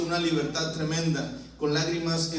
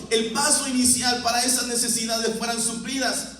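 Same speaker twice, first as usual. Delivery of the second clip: raised pulpit voice, pressing on; fervent preaching; 6 semitones higher than usual. Acted. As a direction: preach it high and urgent. (predicación fervorosa y elevada)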